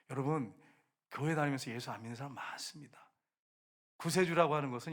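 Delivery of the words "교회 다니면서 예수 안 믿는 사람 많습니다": normal talking voice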